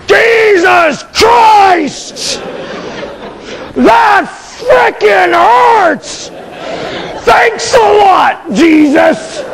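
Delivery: high, very slow mock-agonized yell; cursing in pain. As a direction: stretch each word out in pained outrage. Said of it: "you take the Lord's name in vain".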